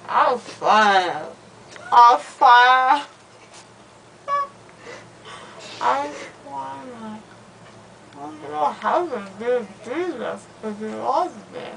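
groggy anesthesia slur; slurred, drowsy, loopy speech; after wisdom teeth. Real, not acted.

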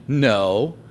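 ordinary delivery

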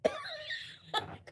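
high, strained mock cough; imitated cough. Streamer imitating a man's cough in a mock cough. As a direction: cough like him in the screenshot. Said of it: "screenshot of him going".

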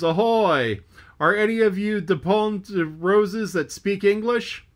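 loud, high hail across the water; bawling hail. Acted. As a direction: shout it to the other deck. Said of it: "he bawled".

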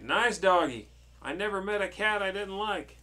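high, loud character voice; delighted storybook witch. Acted. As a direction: coo it loudly like a thrilled cartoon witch. Acted witches' lines in story.

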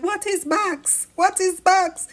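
high, slow mimic of confused Trudy; lost and confused. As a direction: play her, lost, puzzled. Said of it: "you just standing there all lost like".

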